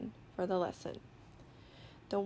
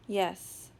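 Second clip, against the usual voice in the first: ordinary delivery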